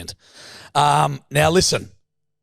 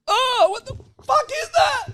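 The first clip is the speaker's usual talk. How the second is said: high shrieking cry of disgust; shrieked disgust. Retold or imitated. Imagined guests at the party reacting to a smell, shrieking. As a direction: squeal it like a grossed-out partygoer.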